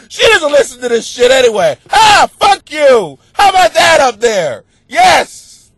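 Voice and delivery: loud, high gleeful taunt; defiant mock shouting. Husband taunting wife who never listens, loud mock defiance. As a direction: holler upstairs in playful defiance.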